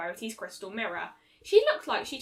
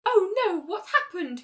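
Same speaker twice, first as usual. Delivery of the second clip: high alarmed gasp of concern; 8 semitones higher, 6 dB louder than usual. Acted. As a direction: rush in worried and high. Rarity alarmed at Fluttershy.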